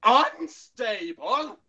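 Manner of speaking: hammed-up indignant echo, rising; Doctor impression. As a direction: explode slowly in outraged disbelief. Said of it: "Here's my Perry and Doctor impression".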